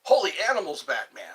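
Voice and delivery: punchy Robin-style exclamation; mock Robin from Batman; hammed-up Holy catchphrase, loud.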